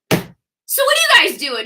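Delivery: high-pitched, chirpy butting-in; breezy husband impression. Husband joins wife and hostess, mock breezy.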